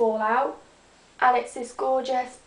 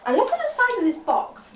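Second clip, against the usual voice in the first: excited; 10 semitones higher than usual. Real, not acted. Excited about birthday box.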